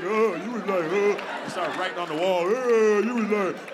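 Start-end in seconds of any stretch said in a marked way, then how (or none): 0.0-0.4 caveman grunt. Retold or imitated. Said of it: "same caveman voice". 0.8-1.2 caveman grunt. Retold or imitated. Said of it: "same caveman voice". 2.5-3.0 caveman grunt. Retold or imitated. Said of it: "same caveman voice".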